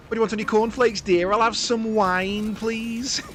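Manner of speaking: high, slow put-upon voice; high mock Lister voice. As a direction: squeak it like a fed-up Lister. Jokey quote in Lister voice.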